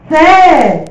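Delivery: 高声大喊着追问学生; 对全班大声喊出问题; loud call to class. Real, not acted. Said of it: "向学生大声提问". (老师提高音量点名问全班是谁)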